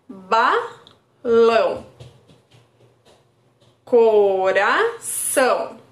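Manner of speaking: very slow, each word drawn out with a falling end; slow rhyme demo. Shown as a demonstration. Therapist drawing out the words for a child, very slow. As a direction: stretch "Balão" and "coração" so the shared "ão" stands out. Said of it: "Aí a criança vai identificar".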